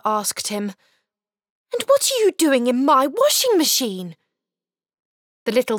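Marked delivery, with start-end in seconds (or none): 1.7-4.2 put-on startled boy. Boy confronting the creature in the drum, startled. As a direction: ask the stranger in shock. Arthur voiced in story.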